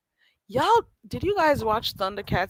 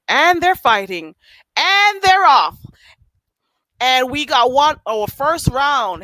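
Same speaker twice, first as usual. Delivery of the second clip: mock fight announcer; 4 semitones higher, 8 dB louder, slower than usual. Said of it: "I'm not a good announcer".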